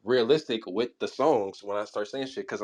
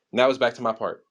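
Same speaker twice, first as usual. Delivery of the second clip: ordinary talk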